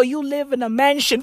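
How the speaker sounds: usual speaking voice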